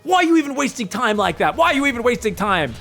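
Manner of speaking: high-pitched sore-loser protest; mock indignant whining. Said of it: "a crying face. He's like".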